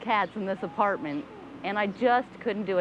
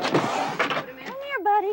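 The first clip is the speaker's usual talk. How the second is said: very high coaxing call; calling a cat; much slower than usual. Real, not acted. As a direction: coax the cat toward you.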